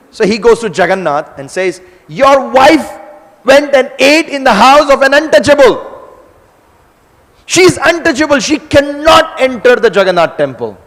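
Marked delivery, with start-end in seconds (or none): 2.1-10.8 high, loud outraged protest; indignant complaint. Acted. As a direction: sound scandalised as you report her. Balaram complains to Jagannath.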